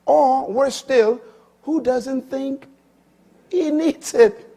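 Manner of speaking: loud, slow, rising preacher's cry; loud, slow sermon peak; preaching at full force. Acted. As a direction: drive it home from the pulpit. Preacher building to a climax.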